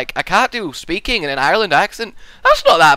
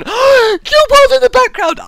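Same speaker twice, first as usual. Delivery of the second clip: sudden high, loud yelp; mock outburst; 9 dB louder than usual. Mock outburst at people in background.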